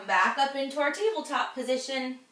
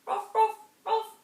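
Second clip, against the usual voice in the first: high yappy ruff ruff; playful puppy barks; dog barks; 8 semitones higher than usual. Shown as a demonstration. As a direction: bark like a puppy for the kids. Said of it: "what do dogs say".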